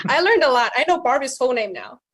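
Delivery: usual speaking voice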